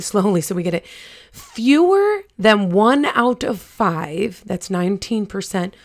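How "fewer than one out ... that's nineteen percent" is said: slow, emphatic statistic, phrase by phrase; measured delivery with weighty pauses; deliberately slow. Real, not acted. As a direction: spell it out slowly so it sinks in. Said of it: "I'm gonna say this slower".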